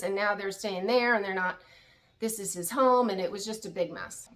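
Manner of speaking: ordinary talk, nothing marked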